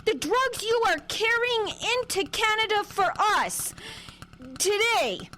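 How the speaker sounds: high, deliberate, hammed-up announcement; staged loud accusation. Daughter loudly incriminates the man for the guard, staged. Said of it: "Then get louder".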